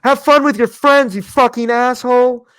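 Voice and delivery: high, loud, slow screaming insult; retold yell. Angry woman yelling at him on the street, high and loud. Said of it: "she goes".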